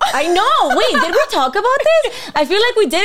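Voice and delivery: high, loud, giggly excitement; excited laughter. Real, not acted. To the host about shared moon signs, excited and laughing. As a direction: gush it out between laughs.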